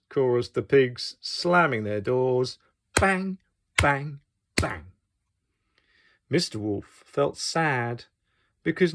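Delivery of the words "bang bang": ordinary delivery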